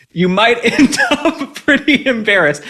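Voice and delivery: high, slow words broken by a laugh. Real, not acted. Advising the girl, laughing as she speaks. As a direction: laugh through the warning.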